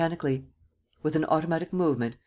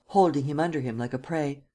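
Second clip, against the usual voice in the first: ordinary delivery, nothing marked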